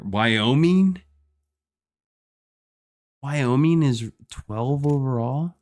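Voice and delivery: can't believe the ranking; surprised. Real, not acted. Streamer reading rankings to viewers, real disbelief.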